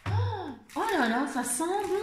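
high, slow, delighted oh. Real, not acted. Vlogger smelling a candle for her viewers, high delighted swoon. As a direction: swoon over the scent.

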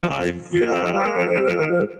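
usual speaking voice

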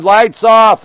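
excited exclaim. Real, not acted. Empire State lights go dark.

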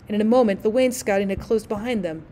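ordinary delivery, nothing marked

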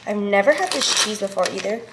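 usual speaking voice

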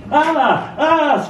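high, loud startled screams; screaming. A narrator's reading. Ball screaming as the collie catches it mid-air. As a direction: yelp it like a ball caught in teeth. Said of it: "screams the ball".

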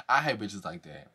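low, quiet grumble; muttered aside. Real, not acted. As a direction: mutter it to yourself, fed up. Repeats the line to herself.

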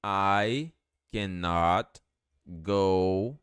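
very slow, word-by-word teaching delivery; slow dictation with gaps between words; slow model phrase. Shown as a demonstration. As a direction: draw out each word for learners. Teacher builds the English sentence slowly.

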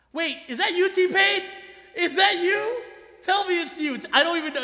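high, urgent astonished questioning; stunned disbelief. Real, not acted. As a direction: voice climbing as you can't believe who just showed up. Stunned that [name] joined chat.

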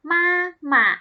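มา หมะ split in two, long then short; model pronunciation. Shown as a demonstration. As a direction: sound out มา, pause, then a brief หมะ. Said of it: "อ่านว่า".

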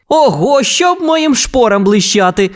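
high-pitched cowboy exclamation; exclaiming. A narrator's reading. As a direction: exclaim in surprise, starting high. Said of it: "вигукнув Вуді".

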